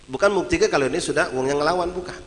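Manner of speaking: usual speaking voice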